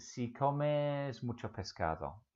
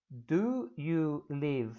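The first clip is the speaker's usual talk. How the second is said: very slow, drawn-out words; slow model question; 3 semitones higher, much slower than usual. Shown as a demonstration. Teacher modelling an English question for learners, very slowly. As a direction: spell the question out slowly, word by word.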